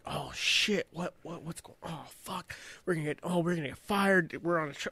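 hushed, frantic panic; whispered mock panic; panicked. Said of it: "he starts panicking".

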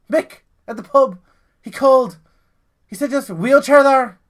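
high, loud wife voice; Irish wife. Acted. Wife tells Paddy the pub called, high and loud.

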